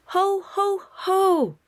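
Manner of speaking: high, loud put-on Santa "Ho, ho, ho!"; a child booming like Santa; kid's Santa laugh. A narrator's reading. Bluey playing Santa catching the peekers, jolly.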